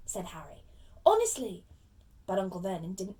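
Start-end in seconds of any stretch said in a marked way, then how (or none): 1.1-1.6 earnest protest. Acted. Boy insists he will behave.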